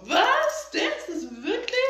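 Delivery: high-pitched incredulous question; mock astonishment. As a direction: replay a stunned double take, starting high. Retells own delayed realization.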